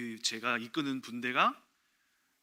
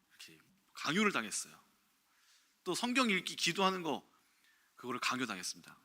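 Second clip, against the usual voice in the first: ordinary talk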